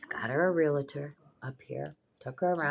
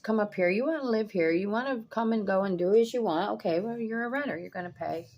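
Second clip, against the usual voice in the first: irritated scolding; 6 semitones higher, 4 dB louder than usual. Retold or imitated. Telling off the lodger.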